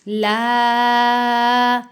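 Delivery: ला held one count; one-count madd. Shown as a demonstration. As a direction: hold the alif of ला one beat. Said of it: "एक अलिफ जितना उसको लंबा करते हैं". (ला को एक अलिफ लंबा)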